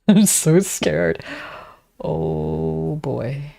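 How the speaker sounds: slow, laughing through it; half-laughing mock fear; drawn-out giggly fright; laughing scared. Real, not acted. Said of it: "I'm scared".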